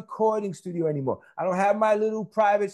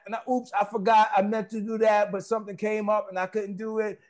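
ordinary delivery

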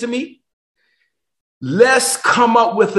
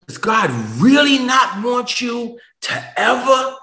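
preaching climax; 5 semitones higher, slower than usual. Acted. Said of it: "preacher building to a climax".

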